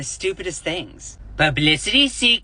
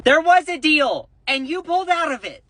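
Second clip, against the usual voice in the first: much higher and louder, accusing; heated retort; 9 semitones higher, 3 dB louder than usual. Real, not acted. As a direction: call him out hard. Angry retort to Trump's post.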